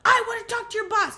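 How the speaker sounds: high, loud retold demand; angry demand. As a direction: imitate an irate passenger insisting.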